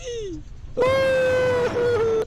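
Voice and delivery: long, very high fake-crying wail; mock wail. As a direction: bawl loudly in hammed-up grief. Mock mourning of Super Kid. (kunwaring atungal ng iyak)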